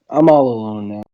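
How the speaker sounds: ordinary delivery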